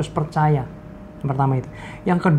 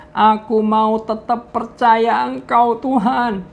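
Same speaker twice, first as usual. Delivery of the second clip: higher, slow, tearful promise; tearful vow; 7 semitones higher, slower than usual. Retold or imitated. Friend promises God to keep trusting, higher and tearful. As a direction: cry out the vow of faith. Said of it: "Dia sambil nangis".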